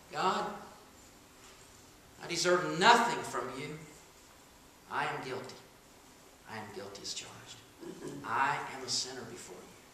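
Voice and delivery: soft, low contrite prayer; humble confession. Retold or imitated. A sinner confessing to God, soft and humble. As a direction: confess quietly, head bowed.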